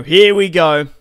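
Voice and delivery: loud, hyped call; excited. Real, not acted. Player announcing the next turn to viewers, loud and hyped. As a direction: kick off the turn with a shout.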